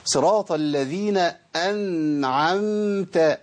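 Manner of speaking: very slow chanted صراط الذين أنعمت with a clear voiced ذ; model recitation. Shown as a demonstration. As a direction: recite it slowly, each letter clean. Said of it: "حلو أوي".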